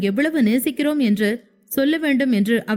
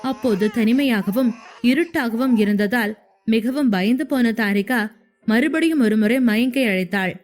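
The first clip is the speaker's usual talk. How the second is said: usual speaking voice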